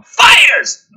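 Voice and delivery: sudden high shout; excited shout. A narrator's reading. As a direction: punch it out loud. Hyped action narration.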